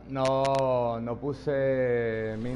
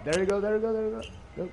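excited cheering; 11 semitones higher, much faster than usual. Real, not acted. Cheering a teammate's kill.

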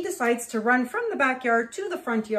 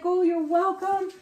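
high-pitched, put-on neighbourly reply; gracious woman; 9 semitones higher, 3 dB louder than usual. Neighbour answers the grateful dog owner, gracious. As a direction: imitate a woman brushing off thanks. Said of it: "And she's like".

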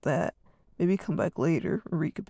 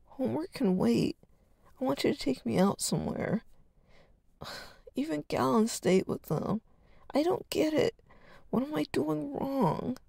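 higher, pleading whine; whining; 7 semitones higher than usual. Acted. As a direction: kid begging his brother, voice climbing. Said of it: "Arden continued whining".

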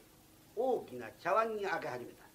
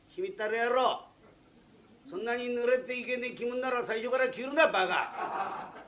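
put-on drunk cursing; 5 semitones higher, 6 dB louder than usual. Drunk guest curses the courtesan.

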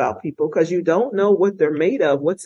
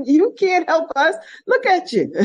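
mock scolding, pitched up; gruff supervisor; 13 semitones higher than usual. Supervisor sending injured trooper home, mock scolding. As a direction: imitate the boss waving her off. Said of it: "He said".

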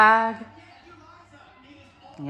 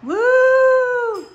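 high, loud whoop; excited cheer; 5 dB louder than usual. Real, not acted. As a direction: cheer like the ball just dropped. New Year's Eve cheering.

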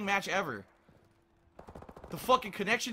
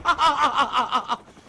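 loud, high string of laughs; helpless frustrated cackle; exasperated laugh; 5 semitones higher, 9 dB louder than usual. Real, not acted. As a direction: burst into incredulous laughter. Laughs in disbelief.

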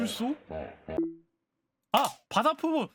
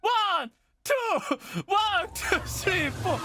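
mock count-in; 8 semitones higher, much slower than usual. Counts in like a band.

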